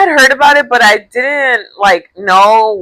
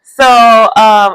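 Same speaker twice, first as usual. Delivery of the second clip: normal talking voice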